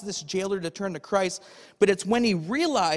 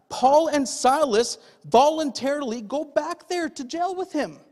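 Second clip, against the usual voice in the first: ordinary delivery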